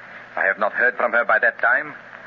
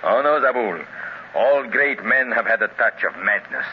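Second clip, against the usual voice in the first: ordinary talk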